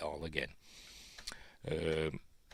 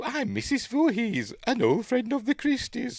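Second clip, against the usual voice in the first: put-on a friendly older woman, secretly sinister; Mrs Voorhees; 3 dB louder than usual. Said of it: "she's meeting Missus Voorhees".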